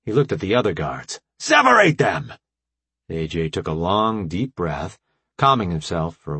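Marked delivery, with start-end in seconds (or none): 1.4-2.3 alien guard command. A narrator's reading. Sarkin guard ordering the fighters apart, loud. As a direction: bark it through a mask.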